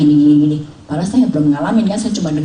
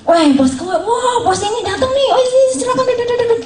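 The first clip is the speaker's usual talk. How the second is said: much higher, eager mock greeting; gushing friends welcoming the boss; excited welcome. Friends welcoming the boss to sit down, high and eager.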